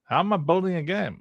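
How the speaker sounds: high-pitched, incredulous question; incredulous echo. Acted. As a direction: throw the question back in mock disbelief.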